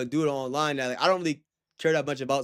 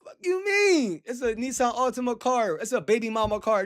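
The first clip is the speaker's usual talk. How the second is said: higher, indignant fuck you mean; pitched-up outrage at a car insult; retold angry outburst; 7 semitones higher than usual. Retelling his reaction to the car insult, indignant and pitched up. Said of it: "I almost lost my cool".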